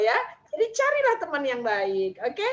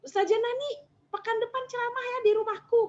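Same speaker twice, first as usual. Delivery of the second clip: imitated friend inviting; 9 semitones higher than usual. Imitates friend inviting her by phone.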